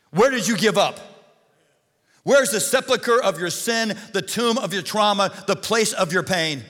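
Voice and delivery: loud, high-pitched pulpit cry; impassioned, ringing sermon delivery; full-force preaching. Real, not acted. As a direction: call the congregation out with each question.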